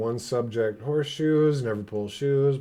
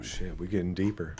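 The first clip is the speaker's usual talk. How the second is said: usual speaking voice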